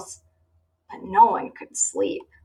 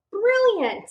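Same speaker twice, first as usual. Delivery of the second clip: delighted; 12 semitones higher than usual. Acted. Benny delighted.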